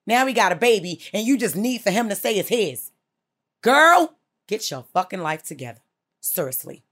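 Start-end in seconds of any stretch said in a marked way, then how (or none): 3.6-4.1 loud scolding call. Real, not acted. Host scolding a caller, loud call. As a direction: call her out sharply.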